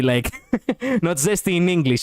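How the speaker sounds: normal talking voice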